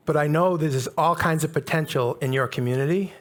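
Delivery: ordinary delivery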